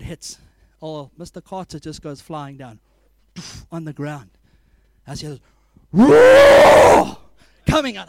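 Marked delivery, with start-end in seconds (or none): 5.9-7.2 huge high roaring shout; imitated lion roar. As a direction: roar out like a lion overtaken by the Spirit. Timid elder roars in meeting.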